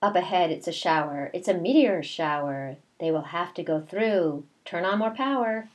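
slow, sing-song read-aloud; reading to children. A narrator's reading. Teacher reading a picture book to her class, slow sing-song. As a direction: read the rhyme slowly to the class.

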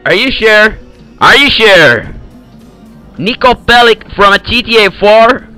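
normal talking voice

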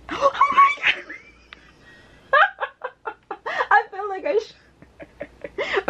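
shrieking high with laughter, overjoyed; ecstatic squeal. Real, not acted. Fan pulls her favorite card, overjoyed squealing. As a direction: lose it with joy, squealing and laughing. Pulling the special card she wanted.

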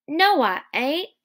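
earnest simple-man voice. Acted. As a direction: insist with childlike earnestness. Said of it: "Lenny explained earnestly".

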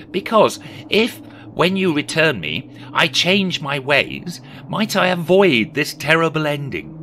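high, loud, dramatic questioning; anxious Scrooge pleading. Acted. Scrooge questioning the silent spirit, pleading.

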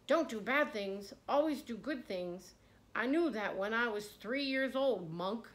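irritated governor. Acted. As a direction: snap back, insulted. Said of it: "Irritated and annoyed, he stammered".

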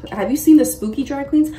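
ordinary delivery

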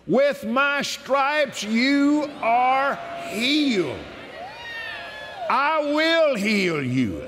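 slow, solemn reading of God's promises; measured devotional scripture reading; scripture proclamation. Acted. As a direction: proclaim each verse as a promise. Reads healing scriptures aloud.

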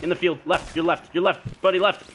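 loud, high urgent shouting; combat callout. Real, not acted. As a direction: bark the enemy's position at your buddy. Calling enemy positions in combat.

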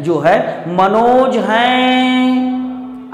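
drawn-out call, last vowel held long; calling someone from afar, holding हैं. Shown as a demonstration. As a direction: stretch the हैं as if calling Manoj. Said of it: "जैसे हम किसी को बुला रहे होते हैं".

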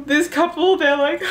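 gasp, then gush high-pitched; gushing swoon. Retold or imitated. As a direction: swoon over the favorite couple. Gushing over sweet scene.

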